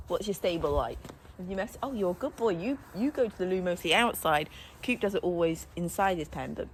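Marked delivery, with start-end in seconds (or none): none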